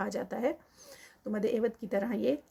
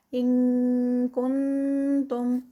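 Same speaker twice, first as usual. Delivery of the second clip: slow, louder, with a held nasal; recited phrase; 2 semitones higher, 7 dB louder, much slower than usual. Shown as a demonstration. Teacher demonstrating the ikhfa, slow and louder. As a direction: hold the nasal sound of كُنتُمْ. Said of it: "shows the ikhfa".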